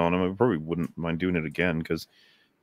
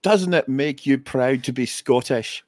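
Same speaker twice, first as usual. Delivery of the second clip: put-on slow, higher-pitched Scottish accent; 5 semitones higher, 3 dB louder, slower than usual. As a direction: deliver the movie line in a drawn-out Scottish accent. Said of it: "you have to do it in a Scottish accent".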